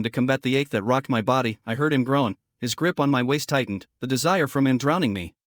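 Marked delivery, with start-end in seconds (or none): none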